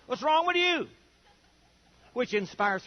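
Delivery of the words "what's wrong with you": high, startled What's wrong with you; husband gapes at his dressed-up wife; mock baffled husband. Husband to dressed-up wife, startled high squeak. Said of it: "and you'll say".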